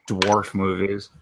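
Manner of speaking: ordinary talk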